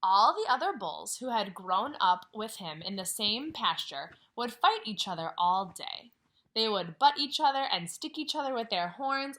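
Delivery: put-on reading a picture book to children; story reading. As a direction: read it out gently to the class. Teacher reads story aloud.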